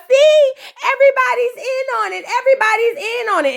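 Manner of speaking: high, hammed-up outraged girlfriend; indignant girl. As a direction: squeal that the whole family's against her. Girl accusing everyone, voiced.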